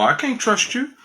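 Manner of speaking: high-pitched imitated women's reply; mock distrust. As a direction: lift the voice high, imitating a doubting woman.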